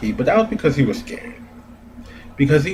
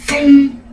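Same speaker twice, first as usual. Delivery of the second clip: loud, high cartoon boom; mock explosion; 9 dB louder than usual. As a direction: belt out a comic-book boom. Mimicking the attack.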